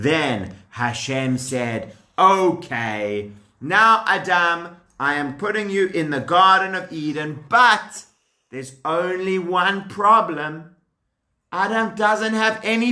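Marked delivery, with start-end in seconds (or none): none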